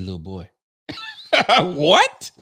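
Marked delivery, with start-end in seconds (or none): 1.6-2.3 loud, high outburst at the player; What? Fam, shouted up high in disbelief; outraged disbelief. Real, not acted. Fan yelling at the player on the clip, loud and high.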